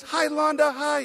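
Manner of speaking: hoch und laut, ein Kind ruft seiner Schwester freudig zu; excited child greeting. Retold or imitated. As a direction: kindliches fröhliches Rufen, deutlich höher. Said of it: "He goes".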